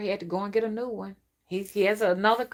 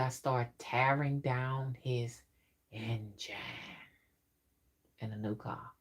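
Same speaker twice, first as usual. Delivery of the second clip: sinister whisper; 6 semitones lower, 8 dB quieter than usual. Acted.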